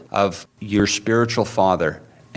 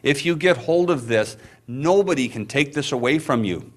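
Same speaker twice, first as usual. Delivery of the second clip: usual speaking voice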